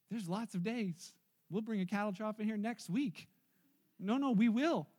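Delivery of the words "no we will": normal talking voice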